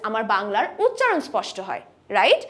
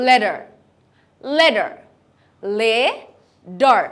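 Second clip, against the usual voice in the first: American flap T; 3 semitones lower, 4 dB louder, much slower than usual. Shown as a demonstration. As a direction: say letter as lédar, light D. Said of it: "In American accent, this is".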